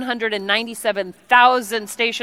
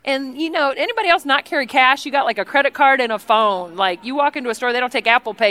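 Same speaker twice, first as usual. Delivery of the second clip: ordinary talk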